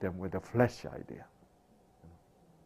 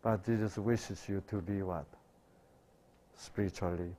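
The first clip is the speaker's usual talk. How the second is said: ordinary talk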